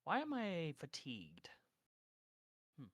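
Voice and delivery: normal talking voice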